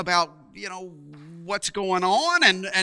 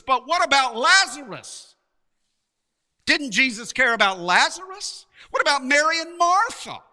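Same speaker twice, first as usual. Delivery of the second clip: higher, insistent questioning; baffled protest; 7 semitones higher, 3 dB louder than usual. Acted. Preacher voices a listener's worry for Lazarus and his sisters, baffled protest. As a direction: protest on their behalf, pitch climbing.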